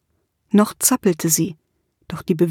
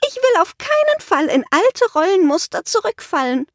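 much higher witch voice, quick and fussy; prim witch; 10 semitones higher than usual. A narrator's reading. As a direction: witch refusing to fall back into old ways. Witch refuses primly.

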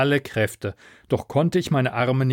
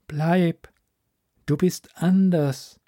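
higher, slowed otherworldly plea; firm alien command; alien insistence; 5 semitones higher, slower than usual. Acted. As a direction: hold her back, stressing anders. Alien commands her to stay.

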